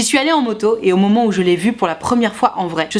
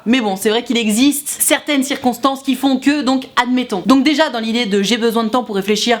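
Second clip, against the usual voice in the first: normal talking voice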